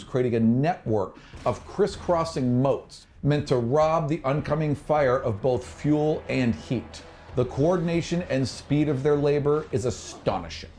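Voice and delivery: usual speaking voice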